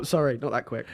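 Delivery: usual speaking voice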